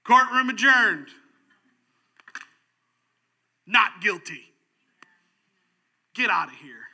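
loud, slow ringing declaration; a judge shouting victory; triumphant verdict. Acted. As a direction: bang the gavel with your voice.

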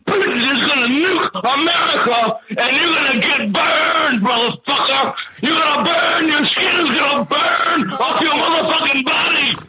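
high, drawn-out screamed curse; real furious shouting. Real, not acted. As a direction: rage at them, stretching the key words as pitch soars. Caller's real furious rant at hosts.

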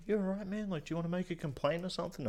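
high, fast, concerned publican; mock publican voice. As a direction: rattle it off high like a worried barman. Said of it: "the publican was like".

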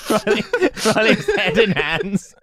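talking through real laughter; breathless giggles between words; laughing. Real, not acted. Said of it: "actually laughing so much".

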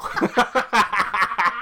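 high giggle; helpless laughter; laughing. Real, not acted. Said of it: "I don't know why that stuck with me".

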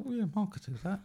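high, soft mock quote; imitating Joseph. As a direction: put on a high little voice for the quote. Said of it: "But Joseph was like".

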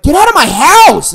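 very loud, high yell; furious shout. Retold or imitated. Ordering the ex out of his house, furious shout. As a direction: scream her out of the house.

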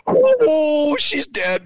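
high, startled cry of alarm; buddy gasping that she's dead; shocked yelp. Retold or imitated. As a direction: yelp it out as if she just died. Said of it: "My buddy Jeff's like".

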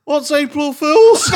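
high, slow puzzled question; baffled question. Retold or imitated. Brother asking what April Fools is, high and slow. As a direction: ask it like you've never heard of it.

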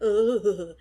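loud shuddering "ugh"; squeamish groan. Real, not acted. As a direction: groan in real revulsion. Squirms, freaked out by the image.

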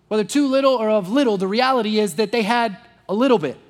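ordinary talk

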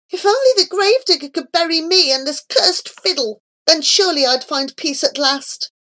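high, hurried wish for release; mildly anguished inner plea; despairing thought. A narrator's reading. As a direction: wish desperately for peace. Remorseful hero's despairing thought.

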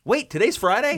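sudden realization, voice jumping up; surprised. Real, not acted. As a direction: caught off guard. Realizing what day it is.

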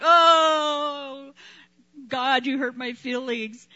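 mock whiny hurt. Mocking offended believer.

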